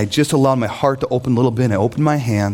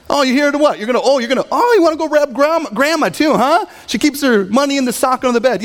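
sarcastic father; 12 semitones higher, faster than usual. Pretend. Father mocking his son for coming back, high and sarcastic. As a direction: sneer at the son's return. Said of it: "Or he could have went the passive-aggressive route".

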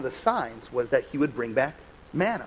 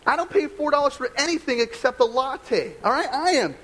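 higher, self-important mock indignation, slowing on his own name; mock entitled rant; 8 semitones higher than usual. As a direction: play up your own spoiled outrage, hammed up. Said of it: "I'm like".

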